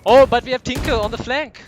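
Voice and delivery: high, loud excited call; excited shout. Real, not acted. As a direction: shout the flank like a big play. Caster calls a flank play.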